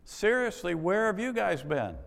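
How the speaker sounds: exasperated. Acted. Exasperated preacher scolds Pharisees.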